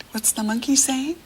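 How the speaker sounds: normal talking voice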